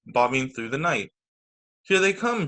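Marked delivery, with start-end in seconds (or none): 1.8-2.5 shout. A narrator's reading. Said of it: "she shouted".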